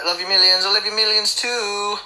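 ordinary talk, nothing marked

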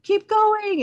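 very high, loud chirpy urging; hammed-up cheerleader push; mock pushy advice. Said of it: "they're like".

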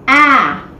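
ah said as a short open a sound; letter sound ah. Shown as a demonstration. Teacher modelling the first sound of apple, a short ah. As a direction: give just the sound ah. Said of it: "the sound ah".

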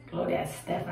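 reads the name under her breath, unvoiced; whispered name. Cashier reading the customer's ID, whispered.

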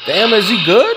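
high and loud, still laughing. Real, not acted. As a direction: ask through the laughter. Laughing, worried for grandpa.

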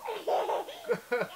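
high-pitched real giggle. Real, not acted. Child's hands in his mouth.

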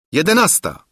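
high, bright exclamation of the hour; calling out. A narrator's reading. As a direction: announce it with a lift. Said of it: "zawołał".

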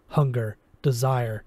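ordinary delivery

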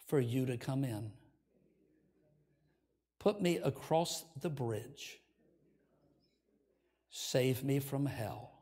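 slow, low, soft prayer voice; leading prayer. Real, not acted. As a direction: pray softly in short phrases, pausing for others to repeat. Leads a repeat-after-me prayer.

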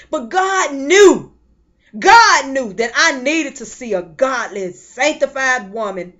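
loud, slow preaching cry. Real, not acted. Preacher testifying about her aunt, rising preaching cry. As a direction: drive it home with fire.

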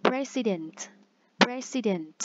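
President đọc rõ từng âm; English word model. Shown as a demonstration. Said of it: "teacher models word twice". (đọc mẫu từ President)

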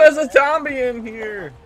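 high, loud alarmed cry; panicking gamer. Real, not acted. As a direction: shout the alarm in fright. Zombie discovered.

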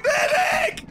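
yelling for a medic; frantic repeated shout for help; man down shout. Real, not acted. Calling for a medic in panic.

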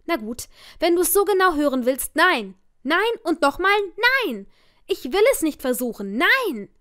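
fed up, shout the nos down the phone; angry refusal. Acted. Narrator finally refuses Fred on the phone, loud and angry.

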